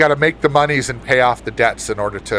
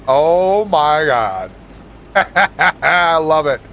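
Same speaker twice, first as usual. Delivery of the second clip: laughing; 3 semitones higher, much slower than usual. Real, not acted. Reacting to a dad joke.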